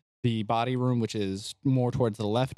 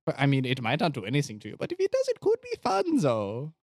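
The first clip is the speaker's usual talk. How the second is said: scheming doctor; faster than usual. Acted. Doctor talks visitor into drinking potion, gleefully shifty. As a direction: play a shifty doctor selling a dubious potion. Plays Doctor Valentine in scene.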